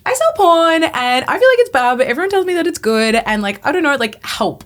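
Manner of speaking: high, loud, tumbling confession; word vomit. Retold or imitated. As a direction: blurt it all out in a rush, pitch up. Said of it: "word vomit to this beautiful woman".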